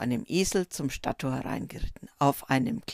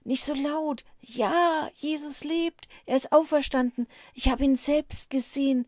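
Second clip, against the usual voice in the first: Maria Magdalena, higher and hushed; quiet high voice; 9 semitones higher than usual. A narrator's reading. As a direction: speak softly, high, urgent. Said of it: "sprach sie leise weiter".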